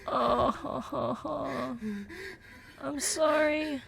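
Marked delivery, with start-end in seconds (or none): 0.1-1.8 soft broken sobs, voice changed; a hushed, shaky crying sound; acted sobbing. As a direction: sob quietly through held breath. Anya crying in the scene.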